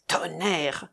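usual speaking voice

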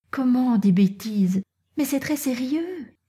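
put-on girl's piqued protest, pitch climbing high; stung, indignant. A narrator's reading. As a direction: protest lightly, voice rising sharply in pitch at the end. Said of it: "dit Natacha piquée au vif".

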